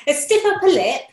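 high, prim English "stiff upper lip"; mock English accent. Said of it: "the English accent".

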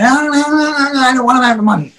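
hammed-up drunken whine; mimicked drunk. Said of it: "So he was fucking drunk".

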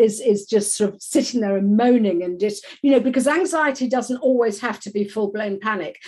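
usual speaking voice

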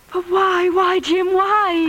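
high, loud, tearful pleading; anguished crying. Acted. Laurie cries out in anguish at Jim's confession. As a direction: break down and beg him why. Wife shocked by husband's confession.

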